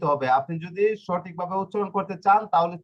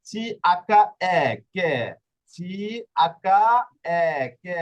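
slow spelled-out syllable demo; 5 dB louder, slower than usual. Shown as a demonstration. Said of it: "এখানে আকা কেন দেওয়া হয়েছে?".

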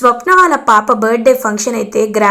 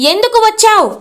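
sharp, raised, high-pitched challenge; loud angry demand; 8 semitones higher than usual. A narrator's reading. Mother-in-law demands why estranged daughter came, loud and angry. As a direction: confront her with a raised voice. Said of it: "అని గట్టిగా అడుగుతారు".

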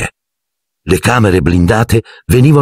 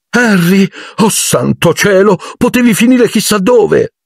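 much higher, anxious mother's cry; frantic mother. A narrator's reading. Mrs Weasley rushing up to Harry, frantic and high. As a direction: fuss over him in a high, worried rush.

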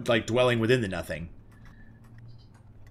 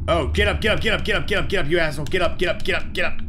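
urgent taunting; 5 semitones higher, 4 dB louder, faster than usual. Real, not acted. Urging a downed enemy to rise.

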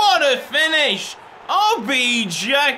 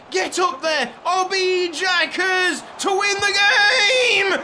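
imitating a teammate shouting; 7 semitones higher than usual. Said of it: "He's shouting".

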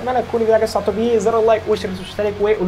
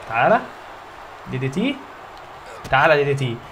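ordinary talk, nothing marked

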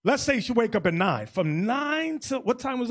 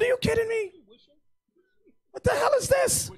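much higher, shrieking mock outrage; exaggerated disbelief; 13 semitones higher than usual. Bishop retelling his outrage at a brother skipping the catacombs, high and shrill. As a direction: act outraged someone skipped the sights. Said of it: "I said".

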